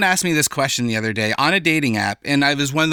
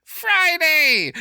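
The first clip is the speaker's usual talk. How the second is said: high, drawn-out cheer; hyped-up, high-pitched whoop of a word; excited; 12 semitones higher than usual. Acted. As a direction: call it out like you're thrilled. Acting out excitement before show.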